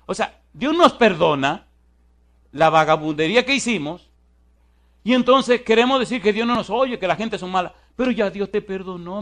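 high, loud impassioned sermon; fervent preaching. Acted. Preacher exhorting the congregation to forgive themselves, fervent.

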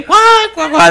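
high, loud mock guffaw; loud quacking fake laugh; imitated crowd laughter. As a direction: imitate a roomful of people cackling. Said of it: "cuando todos se ríen".

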